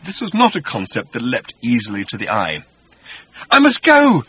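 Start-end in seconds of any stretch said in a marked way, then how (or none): 3.5-4.2 high, loud, urgent protest; insistent. Acted. Arthur trying to get away from Agrajag, insistent cry. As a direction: insist at the top of the voice. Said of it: "insisted Arthur".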